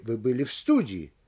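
low start, questioning peak on СТУДИИ. Shown as a demonstration. Lecturer demonstrating a partial question, stress on СТУДИИ. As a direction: save the rise for СТУДИИ. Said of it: "вопросительную интонацию только в одном из них".

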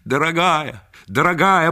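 loud, high, ardent cry; rushing to a beloved. Acted. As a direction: call out to your darling as you rush over. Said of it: "бросился он к ней". (пылко, громко, бросаясь к любимой)

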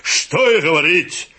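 loud, drawn-out approval; hammed-up praise from a customer; emphatic agreement. A narrator's reading. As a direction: agree heartily, stretching it out. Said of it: "согласился четвёртый".

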